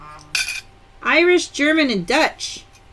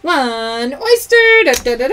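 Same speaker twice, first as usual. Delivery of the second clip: playful prize call; 6 dB louder than usual. Real, not acted. Host reveals an oyster prize on a live game, playful. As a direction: announce it with a mock fanfare.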